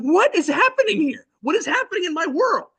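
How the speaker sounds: very high, disbelieving outcry; mock-panicked exclamation, voice shot way up; incredulous alarm. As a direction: reenact a dad's stunned disbelief. Stunned dad watches second son cross.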